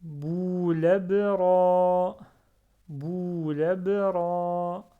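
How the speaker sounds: Boulabra read very slowly with long held vowels; slow drawn-out Boulabra; prolonged vowels. Shown as a demonstration. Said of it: "je prononce deux fois, même trois fois".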